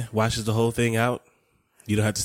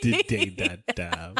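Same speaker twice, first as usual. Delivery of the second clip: laughing; 3 semitones lower, slower than usual. Real, not acted. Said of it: "On where?".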